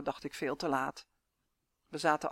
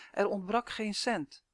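plain reading voice

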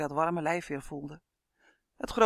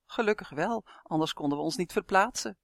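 ordinary delivery, nothing marked

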